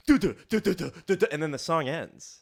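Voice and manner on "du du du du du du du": punchy sung-out drum hits; vocal drum fill. Shown as a demonstration. Showing friends the drop in In the Air Tonight, drumming vocally. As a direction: imitate a drum break with your mouth.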